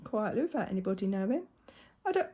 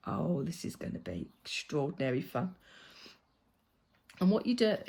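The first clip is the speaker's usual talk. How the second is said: normal talking voice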